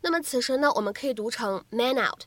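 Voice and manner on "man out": "man out" joined, n carried onto "out"; natural linking. Shown as a demonstration. Teacher showing learners "man out" linked naturally. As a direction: say "man out" as one word, "ma-nout". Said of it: "可以有一个自然的连读".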